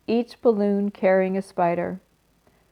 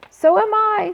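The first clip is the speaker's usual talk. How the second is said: higher, louder tiny voice; 13 semitones higher, 6 dB louder than usual. Acted. Third little spider chiming in with a tiny voice. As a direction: pipe up small and high. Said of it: "said a third voice".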